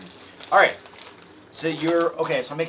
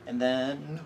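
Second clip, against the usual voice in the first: usual speaking voice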